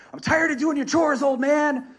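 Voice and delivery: high-pitched mock complaint; pissed-off student. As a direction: imitate a kid fed up with his teacher. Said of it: "Daniel-san gets pissed".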